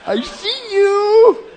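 drawn-out, high, teasing singsong; playful mirror greeting. Retold or imitated. Preacher greeting God in his mirror, playful high singsong. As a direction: coo it high and very slowly, like peekaboo. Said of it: "look in the mirror and say".

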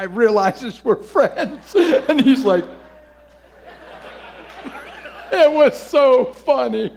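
high, slow words through laughter; laughing while talking. Real, not acted. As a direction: talk through the giggles.

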